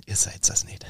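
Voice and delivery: unvoiced inner-thought whisper; mock-pained whisper, a laugh creeping in; whispered disbelief. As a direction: whisper it to yourself, despairing for comic effect. Acts out silent scornful thought.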